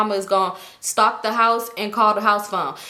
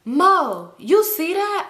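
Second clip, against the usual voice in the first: lightly mimicked excited child, higher pitch; little girl Amari; 8 semitones higher, much slower than usual. Little girl pointing out the baby to her aunt, mimicked child. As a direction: imitate a kid calling out in surprise.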